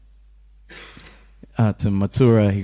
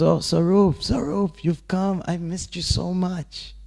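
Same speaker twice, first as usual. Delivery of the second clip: put-on Krishna welcoming his old friend, overjoyed; affectionate joyful greeting; 8 semitones higher, slower than usual. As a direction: greet a long-lost friend with open-hearted delight. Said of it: "he goes".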